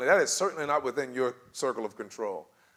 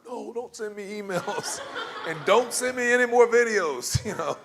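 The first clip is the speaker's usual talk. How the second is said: laughing; 7 semitones higher, 4 dB louder than usual. Real, not acted. Laughing rejection of emails.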